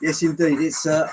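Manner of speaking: ordinary delivery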